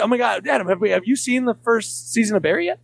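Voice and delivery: high-pitched, over-eager imitation of pestering; mock yelling. Comic re-enacting pestering Adam about Barry, mock excited calling-out. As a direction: gush it high, as if calling across a room. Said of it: "We would just be yelling".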